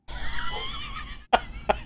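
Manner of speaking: real high shrieking laugh; helpless high-pitched cackle; big laugh. Real, not acted. Cracks up at the joke.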